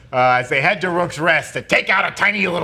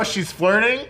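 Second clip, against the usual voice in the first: ordinary talk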